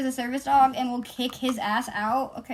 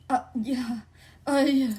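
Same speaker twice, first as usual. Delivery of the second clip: mock-frightened stammer, retold; scared stammering; 2 semitones higher, 4 dB louder than usual. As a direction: imitate a scared onlooker. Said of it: "like, scared because they're witnessing this".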